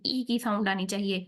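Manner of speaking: usual speaking voice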